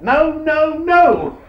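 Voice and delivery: high, loud mock yell of no. McKinnon yelling at the head boy coming up, high and loud. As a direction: shout them off before they arrive.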